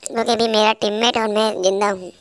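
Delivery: ordinary delivery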